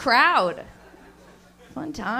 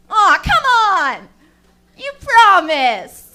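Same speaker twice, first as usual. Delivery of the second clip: loud, slow mock pleading; loud whine; 5 semitones higher, 10 dB louder, much slower than usual. Naked friend begging her, loud slow mock whine. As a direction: whine it loud like a let-down kid.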